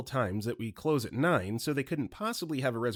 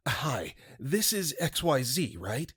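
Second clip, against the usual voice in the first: mildly frantic caller with a drawn-out greeting; frantic, desperate caller; slower than usual. Acted. Caller checking he reached the restaurant, mildly frantic. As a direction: act a desperate man on the phone, slowly stretched. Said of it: "Frantic, desperate male voice".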